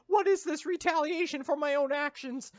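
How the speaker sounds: put-on very high, squeaky child voice; British child voice. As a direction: beg like a whiny British kid. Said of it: "I'm British".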